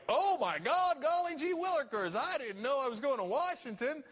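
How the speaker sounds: high, hammed-up Southern naive voice; mock Southern accent. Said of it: "I gave President Obama ... a Southern accent".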